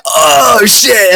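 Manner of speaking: high, sharp alarmed yelp; startled. Retold or imitated. Bully Maguire realizing he's beaten, high startled yelp. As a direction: yelp it caught off guard. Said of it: "And he's like".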